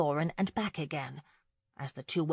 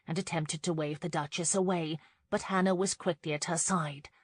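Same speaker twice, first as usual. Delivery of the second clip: plain reading voice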